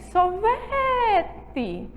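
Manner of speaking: "sorvete" with VE held long and high; exaggerated long VE in "sorvete"; stressed syllable drawn out. Shown as a demonstration. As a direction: stretch the middle of "sorvete". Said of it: "mas eu utilizo a letrinha E".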